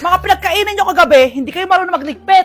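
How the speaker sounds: loud nagging Filipino mum; scolding mother. Acted. Mother scolding her late-sleeping children, loud and nagging. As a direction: yell at the lazy kids to wake up.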